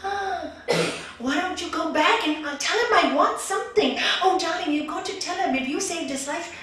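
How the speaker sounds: high, loud, excited urging; storyteller playing an excited, demanding wife; eager, wheedling wife. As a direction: push him eagerly, voice lifted. Wife eagerly urges husband to ask.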